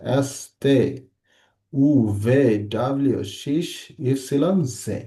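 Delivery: evenly spaced model recitation, আ বে সে as ah, beh, seh; Portuguese letter names. Shown as a demonstration. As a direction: recite আ, বে, সে, দে through জে with open vowels. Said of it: "একটু বোঝার চেষ্টা করেন".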